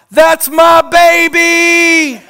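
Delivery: loud, high, drawn-out proud shout; belted shout. Retold or imitated. Parent shouting at the award ceremony, belted proud shout. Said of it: "I belt out".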